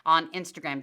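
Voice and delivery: normal talking voice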